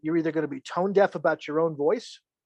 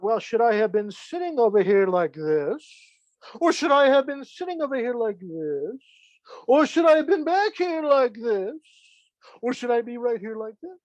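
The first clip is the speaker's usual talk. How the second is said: fretting self-doubt, climbing higher with each question; mock second-guessing; 6 semitones higher than usual. Beginner doubting where they sat, mock fretting that climbs. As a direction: fret over every option, pitch rising.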